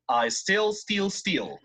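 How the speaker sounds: slow it down for the class to copy; teacher slowly models I still steal; slow model phrase. Shown as a demonstration. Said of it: "I'm going to say it one more time".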